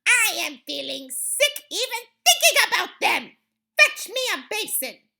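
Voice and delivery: high, loud disgusted witch; screamed. A narrator's reading. Grand High Witch sickened by children, screamed. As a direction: scream as if about to be sick. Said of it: "screamed the Grand High Witch".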